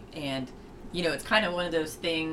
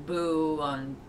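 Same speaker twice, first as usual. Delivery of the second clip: ordinary delivery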